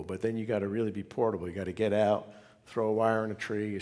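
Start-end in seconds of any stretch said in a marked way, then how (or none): none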